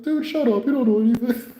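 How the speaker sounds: high, dismissive snap; imitating the patient telling him to shut up. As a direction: brush them off up high.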